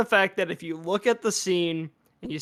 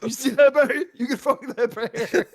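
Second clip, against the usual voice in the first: laughing game-trailer pitchman impression; Todd Howard; 6 semitones higher than usual. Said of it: "a Todd Howard ... be like".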